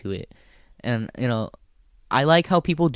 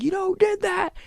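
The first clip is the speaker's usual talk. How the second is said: heckler shout. Retold or imitated. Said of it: "He's like".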